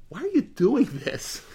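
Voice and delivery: high, pleading woman's voice; wise woman's plea. Retold or imitated. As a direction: ask it like a puzzled townswoman.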